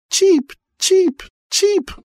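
very high, squeaky cheeps; cartoon bird voice. A narrator's reading. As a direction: peep like a tiny chick. Baby birds crying in grass.

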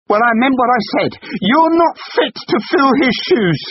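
very high, loud cutting insult; cutting shout. Acted. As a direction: aim to wound him.

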